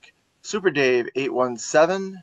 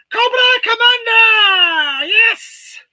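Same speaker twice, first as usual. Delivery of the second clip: shrieked high cartoon villain yell; screeching villain cry; 11 dB louder, much slower than usual. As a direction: screech it like a cackling cobra leader. Shouting the villain's name.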